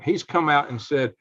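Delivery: normal talking voice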